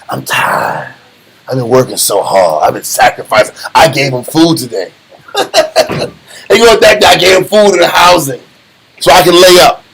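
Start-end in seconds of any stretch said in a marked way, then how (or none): 0.1-4.9 low, whiny mock complaint; a tired lazy parent whining low; lazy parent excuse. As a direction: sulk like a worn-out parent.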